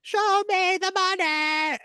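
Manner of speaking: high, slow, stretched-out hammed yell; over-the-top mock Tom Cruise yell; unhinged yell. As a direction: belt it like a man losing it. Imitating Cruise's unhinged reading.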